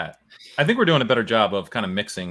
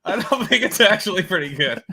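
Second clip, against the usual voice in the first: high, loud, laughing through the words; can barely get it out for laughing; 13 semitones higher, 7 dB louder than usual. Real, not acted.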